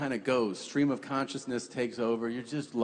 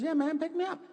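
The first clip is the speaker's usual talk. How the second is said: put-on much higher squeaky child's voice, insistent; little kid voice; faster than usual. Six-year-old begging his dad to pick him up, high child voice. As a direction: go way up like a small boy begging. Son insists on being held.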